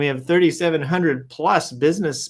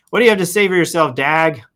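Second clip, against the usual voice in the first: fast callout; 2 semitones higher, 5 dB louder than usual. Real, not acted. Calling out to Dag.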